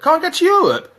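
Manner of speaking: high-pitched disbelieving echo; incredulous. Real, not acted. Fan scoffs at a pundit's claim, incredulous. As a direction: throw it back, high and scoffing.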